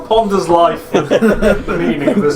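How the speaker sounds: slow, loud, laughing mock musing. Real, not acted. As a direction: drawl it out while laughing. Player mocks with laughter.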